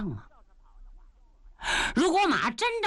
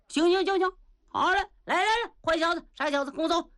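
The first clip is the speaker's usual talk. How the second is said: high, hearty and impatient; brash Niu Gao voice; 10 semitones higher, much faster than usual. Acted. Niu Gao calling the boy to follow him, high and hearty. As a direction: chivvy him along in a high gruff voice.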